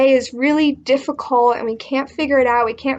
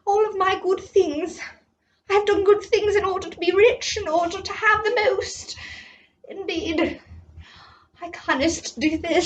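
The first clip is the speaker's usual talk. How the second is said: a higher, put-on voice, clinging to his riches; whining rich man; 7 semitones higher, 5 dB quieter than usual. Storyteller plays the rich man, whining. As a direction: whine like a man who can't part with his things. Voicing the rich man's lament.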